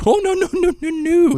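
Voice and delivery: very high, singsong string of protests; mock chuckling denial. Jocasta corrects talk of livestock, mock high chuckling protest. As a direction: trill it up high, lightly dismissive. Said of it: "with, like, a chuckle".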